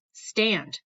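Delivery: put-on a curt, raspy command from the old teacher; rasped order. A narrator's reading. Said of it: "she rasps".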